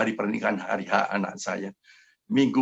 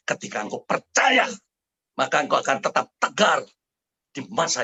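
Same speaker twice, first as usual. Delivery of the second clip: emphatic exhortation; 4 semitones higher than usual. Real, not acted. Preacher exhorting congregation to believe.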